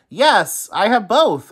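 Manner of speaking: reply like Oscar; Oscar answering. A narrator's reading. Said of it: "Oscar replied".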